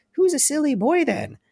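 very high, cooing doll taunt; talking doll voice. Retold or imitated. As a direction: coo it like a creepy toy. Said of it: "this voice. It says".

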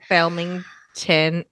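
Felmington said slowly, syllable by syllable; slow drawn-out name. Retold or imitated. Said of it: "comes up with that on the spot".

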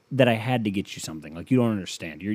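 ordinary talk

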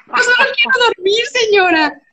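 high-pitched mock whine; súplica lastimera. Tenant pleading to be left alone. (quejido fingido y agudo)

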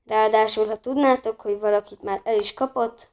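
plain reading voice

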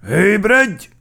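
loud, high command; wake-up shout. A narrator's reading. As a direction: yell to rouse a sleeper. Officer waking the sleeping car.

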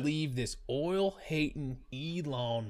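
slow, hammed-up Southern drawl; Southern accent. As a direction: rant slowly like a Southerner. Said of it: "goes straight Southern".

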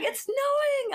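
high, drawn-out fake surprise; excited. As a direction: sell the fake snow news. Faking alarm to wake husband.

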